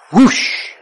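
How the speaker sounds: loud, airy rushing whoosh; imitated whoosh. Preacher recalling the tree falling in the yard, loud imitated whoosh. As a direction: whoosh like a big branch crashing down. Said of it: "very, very loud".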